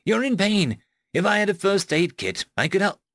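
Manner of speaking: worried. A narrator's reading. Rose wants to help the injured narrator, mildly worried. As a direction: plead lightly, with a touch of worry. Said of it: "she said worriedly".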